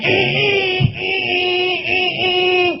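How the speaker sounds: high repeated eh honks; mock horn blasts; imitating car horn. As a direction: honk it out with your voice. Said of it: "honk the horn like".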